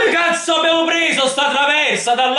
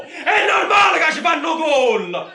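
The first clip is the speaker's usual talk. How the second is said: explosive Italian cursing; 3 semitones higher than usual. Real, not acted. Fan cursing at team.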